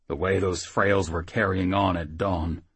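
plain reading voice

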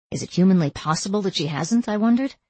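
ordinary delivery, nothing marked

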